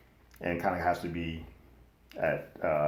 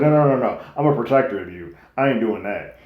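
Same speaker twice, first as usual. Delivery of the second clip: refusing; 5 semitones higher, 9 dB louder than usual. Retold or imitated. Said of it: "Criston's like".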